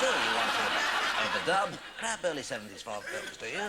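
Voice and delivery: slow, loud put-on interviewer; mock-pompous interviewer drawing it out; slow hammed-up casting man. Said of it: "a guy came to the door and said".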